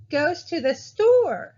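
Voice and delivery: slow and loud, "store" stretched high and falling; drawn-out STORE. Shown as a demonstration. Teacher showing how sentences end, "store" held longer. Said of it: "I draw out store a little bit".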